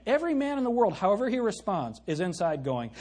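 usual speaking voice

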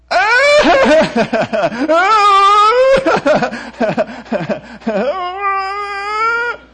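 put-on high, loud toddler laugh-cry squeal; laugh-cry imitation. Shown as a demonstration. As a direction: squeal like a baby caught between laughing and crying. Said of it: "I don't know whether she's laughing or crying".

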